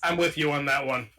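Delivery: normal talking voice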